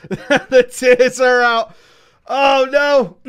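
slow, loud laughing words; real laughter. Real, not acted. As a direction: laugh through the line. Laughing at his crying.

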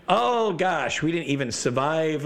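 high-pitched disbelief; players' exaggerated exasperation; mock dismay. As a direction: lament it with hammed-up shock.

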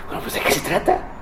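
near-silent whisper, unbothered; God's hushed reply. Acted. God whispering calmly to boastful Peter. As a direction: breathe it, almost no voice.

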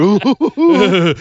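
high, playful wailing oohs; a string of hammed-up ghostly oohs; mock spooky oohs. Joking about hackers backstage at the light switches, mock spooky oohs.

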